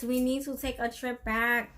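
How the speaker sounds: ordinary talk, nothing marked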